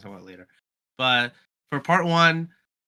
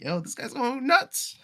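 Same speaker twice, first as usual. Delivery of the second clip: excited; 11 semitones higher, slower than usual. Retold or imitated. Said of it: "I was like".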